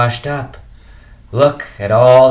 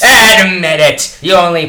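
bitter host shouting at the visitors; angry demand; 8 semitones higher, 11 dB louder than usual. Acted. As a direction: hammer each word home. Rogers bitterly challenges them.

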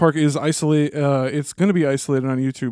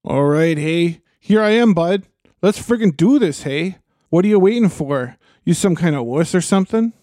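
put-on Minnesota accent; 2 semitones higher than usual. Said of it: "Minnesota Witch King".

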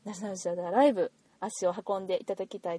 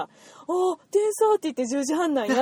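higher, louder dawning surprise; sudden realisation; 7 semitones higher, 6 dB louder than usual. Retold or imitated. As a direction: blurt it out as it finally clicks. Acting out her sudden realisation. (はっと気づいたように高く大きめに)

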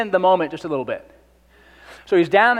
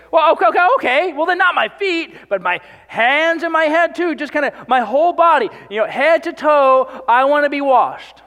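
high, loud, hurried over-eagerness; eager turnaround; 9 semitones higher, 5 dB louder than usual. Retold or imitated. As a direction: gush like a student begging for extra credit. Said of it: "He's about to change his tune really quickly".

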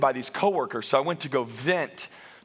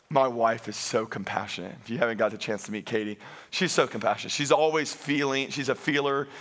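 ordinary talk, nothing marked

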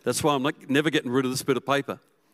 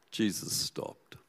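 slow hushed whisper; 3 semitones lower, 8 dB quieter, much slower than usual. A narrator's reading. Preacher lets the moment land, whispered slowly. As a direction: breathe it out in awe, slowly. Repeated for emphasis.

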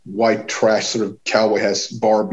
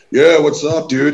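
imitating stoner greeting; 5 semitones higher, 7 dB louder than usual. Stoned band guys greeting.

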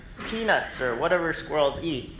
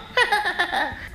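laugh. Real, not acted. Laughing at own squirrel story.

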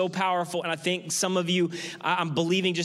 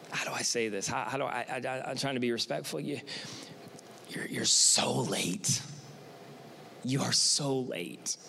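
hesitant Martha; 8 semitones lower, 7 dB quieter than usual. Acted. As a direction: tiptoe around telling Jesus he's late. Martha hesitantly confronting Jesus.

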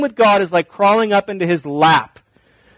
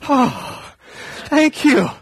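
a hammed-up, higher "oh, thank you"; mock surprised thanks; 10 semitones higher, much slower than usual. Kid thanking dad for the yearly I love you, mock surprised. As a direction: play the kid who is touched just once a year.

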